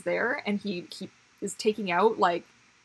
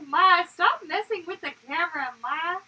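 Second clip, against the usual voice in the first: put-on baby's voice; 9 semitones higher, 4 dB louder than usual. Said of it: "He's like".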